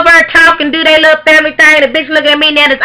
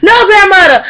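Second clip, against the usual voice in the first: mock child's crying plea, higher; crying kids; 8 semitones higher than usual. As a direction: beg like a sobbing little kid. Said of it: "little kids start crying".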